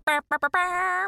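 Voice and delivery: high loud sung trumpet call; mock trumpet fanfare. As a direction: hum a herald's fanfare.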